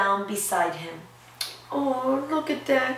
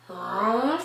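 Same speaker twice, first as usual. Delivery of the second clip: walrus huh. Acted. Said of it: "Squeezed Walrus".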